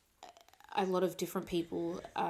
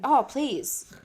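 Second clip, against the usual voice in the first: Oh pushed higher and louder; louder Oh, please; louder emphatic; 6 semitones higher, 10 dB louder than usual. Real, not acted. Repeated, louder reassurance.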